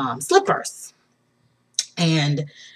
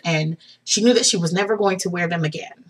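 ordinary talk

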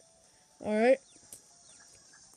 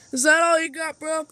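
taunting; 5 semitones higher, 6 dB louder than usual. Acted. Taunting opponent.

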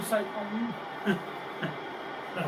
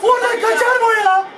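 shout; 7 semitones higher than usual. Real, not acted. Friends react to missed goal.

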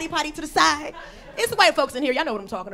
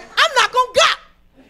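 high, loud, slow protest; mock-alarmed yell, each word stretched; loud refusal; 11 semitones higher, 11 dB louder, slower than usual. Comic telling friends about the invitation, loud refusal.

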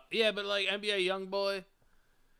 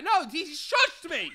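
high, loud, slow laughing complaint; mock grievance; 11 semitones higher, 8 dB louder, slower than usual. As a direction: complain he was shushed. Aggrieved victim.

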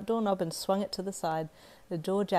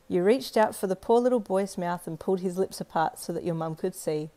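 usual speaking voice

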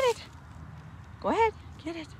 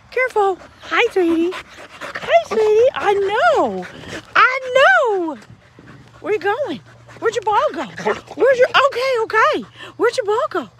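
high, loud doting pet voice; 9 semitones higher, 6 dB louder than usual. Real, not acted. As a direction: coo at the puppy, pitched way up. High baby talk to puppy.

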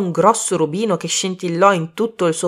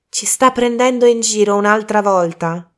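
raised, higher accusing exclamation; crying out; 5 semitones higher, 5 dB louder than usual. A narrator's reading. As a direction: call him out loudly, in mild protest. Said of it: "gridò". (esclamazione a voce alta)

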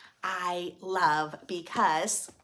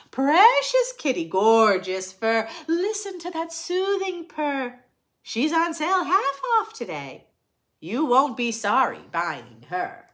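lively, sing-song picture book reading; reading to children; 5 semitones higher, 7 dB louder than usual. A narrator's reading. Reading a rhyming picture book to children, sing-song. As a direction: read it brightly to the little ones.

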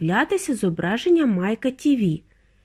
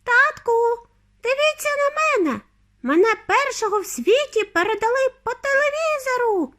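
very high, excited mouse-like squeak; thin, squealing little boy; tiny squeaky voice. A narrator's reading. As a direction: pipe up proudly in a teeny voice. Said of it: "пищав Майк".

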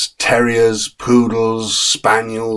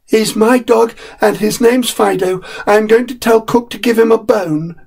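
put-on higher, proud boy's voice; a small boy claiming his dog; boy Peter, stubborn; 13 semitones higher than usual. Peter insists to his parents, boyish and higher.

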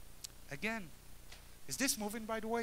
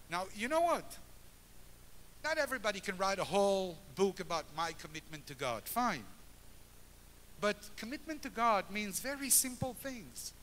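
raised, high preaching voice; rousing preaching; 4 semitones higher than usual. Acted. Speaker to congregation about a united Jerusalem, fervent preaching. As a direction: lift the congregation with fervour.

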